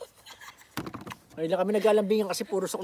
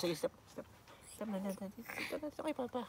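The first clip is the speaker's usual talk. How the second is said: soft teasing whisper; 7 semitones higher, 16 dB quieter than usual. Real, not acted. Dad teasing, soft hushed.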